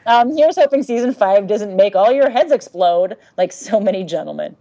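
ordinary talk

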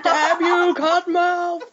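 high, hammed-up fist-shaking cry; sputtering foiled villain. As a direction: curse the sky like a foiled villain. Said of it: "shake his fist at the sky".

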